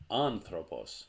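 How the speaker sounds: "Anthropos" with the first syllable stressed. Shown as a demonstration. Teacher showing where the accent falls, stress on first syllable of "Anthropos". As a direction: hit the opening "An" of "Anthropos". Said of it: "stress of the word goes on this syllable".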